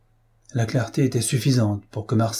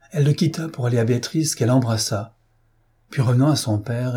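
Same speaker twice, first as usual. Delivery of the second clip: ordinary delivery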